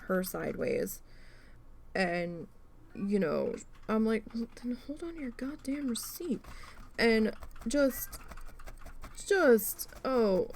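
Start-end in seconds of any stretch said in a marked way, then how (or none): 4.3-6.4 whispered under the breath, biting; whispered aside. Retold or imitated. Clerk secretly snapping back at the customer, whispered. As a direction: hiss it so she can't hear. Private retort to rude customer.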